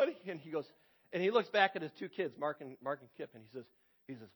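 ordinary talk

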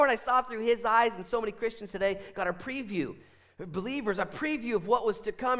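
high, forceful exhortation; fervent preaching. Real, not acted. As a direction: drive the lesson home with passion.

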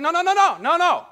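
high, loud frantic denials; panicked pleading. Retold or imitated. Brothers panicking as they deny being spies, high and loud. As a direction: plead fast like scared men caught out. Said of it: "And the brothers are like".

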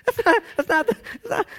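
very high, loud squeaky sputter; loud high-pitched stammering objection; incredulous protest. Pretend. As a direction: splutter in comic disbelief. Mock protest at absurd question.

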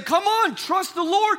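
high, loud pushy "trust the Lord"; impatient urging. Pretend. Preacher mimicking Christians pushing a griever, high and loud. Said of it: "We're saying".

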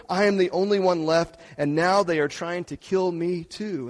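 loud, forceful scripture reading; preached scripture reading. Acted. Pastor reads First Kings to the church, loud and forceful. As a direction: proclaim the text to the whole church.

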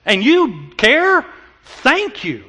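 slow, amazed thanks; grateful surprise. Retold or imitated. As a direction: marvel that someone cares. Grateful youth quoted.

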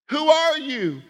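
high, loud "Who are you?" with "are" punched; pressing question. Shown as a demonstration. Preacher repeatedly pressing the congregation: who are you. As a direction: demand it with "are" stressed. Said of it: "If I=to say".

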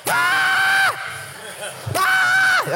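very high, loud pent-up shriek; hammed-up bursting scream; comic scream. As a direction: let out two short shrieks. Acting out the pent-up secret.

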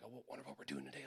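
hushed, hurried nervous thought; scared, intimidated. Retold or imitated. New soldier wondering to himself, intimidated. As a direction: think it timidly under your breath. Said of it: "I'm really scared, intimidated".

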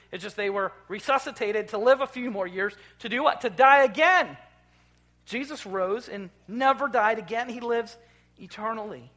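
fervent sermon crescendo; preaching at full force. Acted. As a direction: ring it out over the congregation. Building to the good news.

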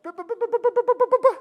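high, sputtering babble; feeble awed stammer. Acted. Believer trying to praise in glory, high speechless stammer. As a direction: stammer as if words will not come. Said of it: "that's about the best I'll muster".

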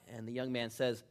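ordinary talk, nothing marked

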